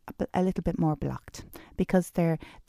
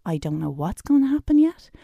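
higher, louder determined declaration; firm, raised inner voice of resolve; emphatic decision; 5 semitones higher, 4 dB louder than usual. Retold or imitated. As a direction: announce the decision like nothing can stop you. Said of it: "a real emphatic effort decision".

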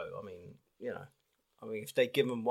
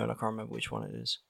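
usual speaking voice

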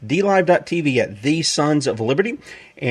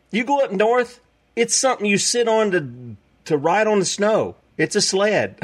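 mock incredulous; 7 semitones higher than usual. Shown as a demonstration. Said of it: "You come down here".

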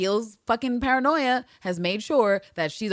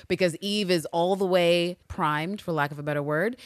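ordinary talk, nothing marked

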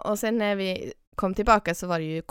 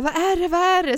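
high, loud, urgent alarm; alarmed guide; 10 semitones higher, 7 dB louder, faster than usual. Retold or imitated. Guide rushes over thinking the campers were attacked, alarmed. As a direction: mimic a guide fearing an attack. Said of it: "så han bara".

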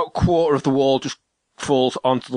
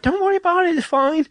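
much higher, mock-sweet reassurance; high imitation of Ash. Husband mimics Ash reassuring him, in a much higher voice. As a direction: lift the voice way up to play his partner soothing him. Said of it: "she's like".

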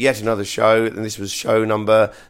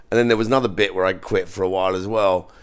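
ordinary talk, nothing marked

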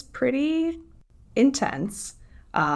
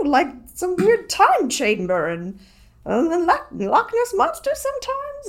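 high, wobbly put-on British accent; shifting British accent; 8 semitones higher than usual. Summarizing the book after changing accents midstream, put-on British accent. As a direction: keep up a high, hammed-up British voice. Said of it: "oh, I'm changing accents".

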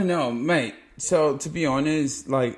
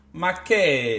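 mock-Italian outburst, raised in volume; Italian exclamation; 4 dB louder than usual. Showing a friend how Italian is spoken, mock-Italian yell. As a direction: throw it out like an exasperated Italian. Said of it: "you have to do something like".

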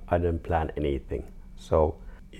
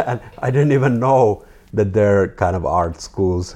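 louder words breaking through laughter; laughing while talking; 4 semitones higher, 11 dB louder than usual. Real, not acted. Artist telling interviewer he didn't know art schools existed, laughing. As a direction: keep laughing through the line.

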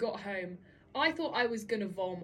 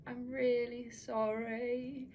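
put-on drunk apology; 2 semitones higher, 6 dB louder, slower than usual. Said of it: "walking home being like".

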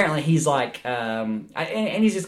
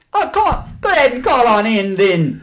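high, loud hammed-up Jamaican accent; Miss Cleo Jamaican accent; 9 semitones higher, 6 dB louder than usual. Imitating Miss Cleo for a friend, high loud Jamaican accent. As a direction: do Miss Cleo's Jamaican psychic hotline voice. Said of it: "I was like".

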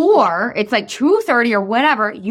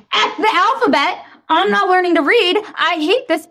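put-on frustrated kid; 7 semitones higher than usual. Imagined frustrated daughter.

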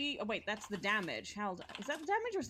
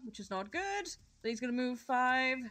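usual speaking voice

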